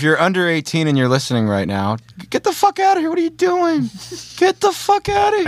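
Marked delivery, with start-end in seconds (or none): 2.3-5.5 much higher, yelping shoo-away; mock shooing shout. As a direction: squeal them out of the room. Shooing away underage listeners.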